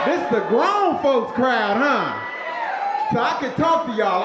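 calling out to crowd. Real, not acted.

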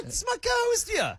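high excited call-out; excited shout. Real, not acted. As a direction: announce with big excitement. Excited introduction of co-host.

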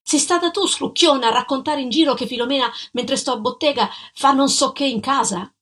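enraged husband confronting the ex-fiancée; furious accusation. Acted. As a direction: hurl the accusation at her. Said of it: "Io inviperito invece glielo dissi".

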